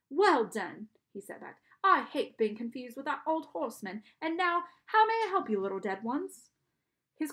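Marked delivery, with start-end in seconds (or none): 0.1-0.9 loud, lightly British praise; strange British accent. A narrator's reading. Charon praises the boy, strange British accent. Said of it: "He had a strange accent". 1.8-6.5 loud British-sounding ferryman, swooping high; theatrical host with an odd British accent; strange British accent. A narrator's reading. Charon receives dead children at his desk, strange British accent. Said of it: "He had a strange accent".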